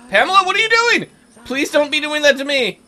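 high, loud mock-alarmed plea; playful panic. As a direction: plead in exaggerated alarm. Witch casts something at player.

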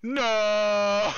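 high, loud "no" held long; anguished scream. Real, not acted. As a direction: cry out as if all is lost. Dismay at game setback.

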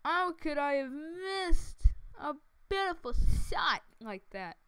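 rising melodramatic wail; mock despair. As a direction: cry out in pretend agony over a miss. Mock despair at missed shot.

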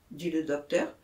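ordinary delivery

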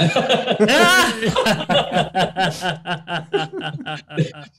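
delighted laugh; long hearty laugh. Real, not acted. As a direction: let the laugh roll on. Laughing at eating free.

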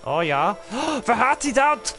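ordinary talk

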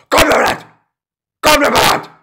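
high, loud frantic shout; high-pitched yell. Acted. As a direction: screech it out at the stuck character. Yelling at a stuck game character.

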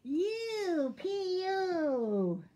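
long, high, loud disgust; Raccoon. A narrator's reading. Raccoon smells Skunk, drawn-out loud disgust. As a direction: stretch the vowels, swooping down. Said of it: "said Raccoon".

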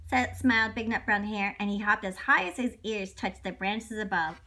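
ordinary delivery

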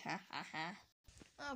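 slow, low, soft mock laugh; evil laugh. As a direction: gloat quietly. Villain laugh at cannon.

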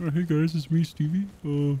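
a hint of Stevie's voice; Stevie impression. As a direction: greet the squad like a chirpy youtuber. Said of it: "I'll do my Stevie impression".

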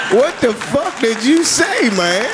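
baffled. Retold or imitated. Baffled reply to gibberish.

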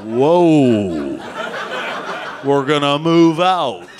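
rally the troops like a cavalry officer; cavalry commander. Pretend. Gardener pretending to lead the cavalry, mock command. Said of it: "pretend they're in the cavalry".

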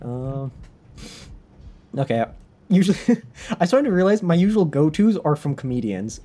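ordinary talk, nothing marked

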